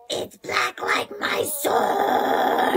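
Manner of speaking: slow, voiceless rasp, stretched out; dark and dramatic. Acted. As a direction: draw out the last word darkly.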